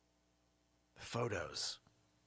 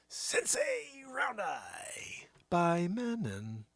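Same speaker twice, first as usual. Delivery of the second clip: mock ad whisper; 4 semitones higher, much slower than usual. Announcing a brand name.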